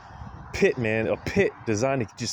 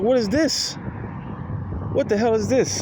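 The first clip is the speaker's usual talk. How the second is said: bewildered caged lion; 7 semitones higher than usual. Retold or imitated. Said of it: "it's like".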